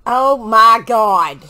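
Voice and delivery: loud slow horrified oh my God; watching the brain scene, slow loud horror. Real, not acted. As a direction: draw it out slowly and loudly in horror. Horrified at screen.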